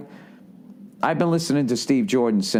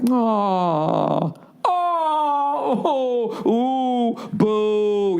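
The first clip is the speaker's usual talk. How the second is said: high mock sympathetic "aw, oh, ooh, boo"; pitying crowd groans; 11 semitones higher, much slower than usual. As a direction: imitate a pitying benefit crowd, cooing and booing. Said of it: "Those are the sounds I usually hear at benefits".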